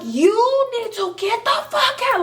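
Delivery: high shouted order; shrill shout ordering her boyfriend out; retold yelling. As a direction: yell him out of the house. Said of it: "I'm yelling at him".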